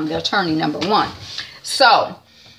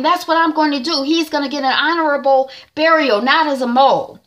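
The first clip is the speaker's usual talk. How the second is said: impassioned vow; 7 semitones higher than usual. Retold or imitated. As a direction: swear it like a sacred oath, pitched high. Advocate vowing to clear Knox.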